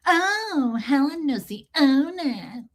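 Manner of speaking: high, slow, affected Bridesmaids voice; mock-impressed, slow and high; Kristen Wiig impression. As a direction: stretch it out like a snooty Kristen Wiig. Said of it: "She did the Kristen Wiig thing from Bridesmaids".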